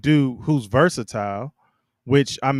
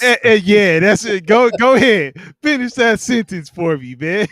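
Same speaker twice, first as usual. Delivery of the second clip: loud, high-pitched urging; excited, high and loud goading; gleeful egging on; 9 semitones higher, 8 dB louder than usual. Real, not acted. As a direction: egg your friend on, loudly. Gleefully egging on the co-host's innuendo.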